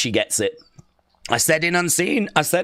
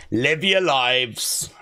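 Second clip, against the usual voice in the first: "Live your lives" slow, stretched, lower; drawn-out Big Jim; 7 semitones lower, much slower than usual. Retold or imitated. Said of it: "Big Jim backstage right now is like".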